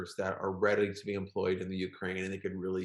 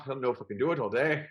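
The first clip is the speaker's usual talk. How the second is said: put-on Irish accent; 2 semitones higher, faster than usual. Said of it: "Do more".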